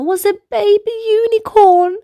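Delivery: usual speaking voice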